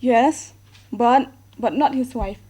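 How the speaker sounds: ordinary talk, nothing marked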